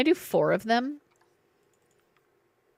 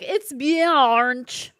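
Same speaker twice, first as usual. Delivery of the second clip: louder, hammed-up Kiwi imitation; mock a goofy New Zealander; exaggerated Kiwi accent, played for laughs; 5 dB louder than usual. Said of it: "speaks in a very goofy way".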